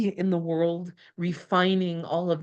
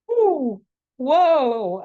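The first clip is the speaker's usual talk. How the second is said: amazed; 7 semitones higher, 6 dB louder than usual. Real, not acted. Moved by the Ten of Cups.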